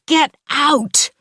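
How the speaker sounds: high, loud shout at the intruder. A narrator's reading. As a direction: yell her out the door. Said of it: "I'm shouting".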